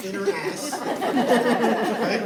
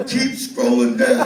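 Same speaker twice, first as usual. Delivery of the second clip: mock brother; 3 semitones higher, 9 dB louder, slower than usual. Brother quoted, loud build.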